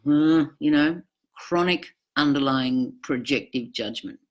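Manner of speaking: usual speaking voice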